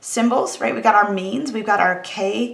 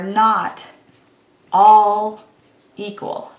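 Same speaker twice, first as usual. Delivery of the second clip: "all" much louder, set apart by pauses; stress on "all"; 6 dB louder, much slower than usual. Real, not acted. Stats teacher spelling out the complement, heavy stress on "all". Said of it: "I wanna emphasize the word all".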